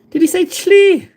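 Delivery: high, loud teasing squeak of a viewer; high squeaky mock viewer; mocking chat voice. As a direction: pipe up in a high loud voice, poking fun. Mock chat voice reading comment.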